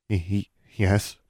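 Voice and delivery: chattering stammer. A narrator's reading. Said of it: "teeth chattering".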